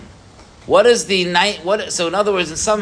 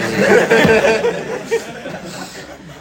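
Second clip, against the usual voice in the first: laugh; 6 semitones higher than usual. Real, not acted. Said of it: "That was really".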